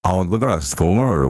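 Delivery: ordinary delivery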